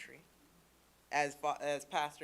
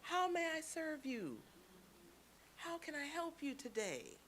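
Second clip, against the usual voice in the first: restaurant server greeting; 9 semitones higher, 7 dB quieter than usual. Acted. Server greeting restaurant customers, high-pitched service voice. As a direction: politely sing out a server's greeting. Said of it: "favorite phrase that they use at these restaurants".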